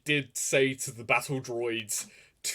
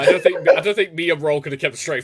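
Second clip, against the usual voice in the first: laughing; 3 semitones higher, 9 dB louder than usual. Real, not acted. Laughing about baby voice.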